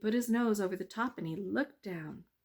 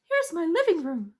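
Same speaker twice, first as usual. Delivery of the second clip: high, loud tricky mouse; 10 semitones higher, 9 dB louder than usual. Acted. Mouse tricking the bees, high and sly.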